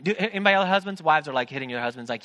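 normal talking voice

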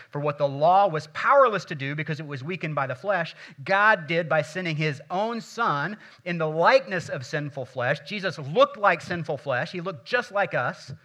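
loud, rising preacher's delivery; preaching at full force. Acted. Pastor to congregation, preaching loud and strong. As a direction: proclaim it to the congregation.

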